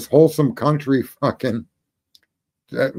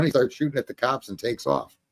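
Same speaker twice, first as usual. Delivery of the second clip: ordinary talk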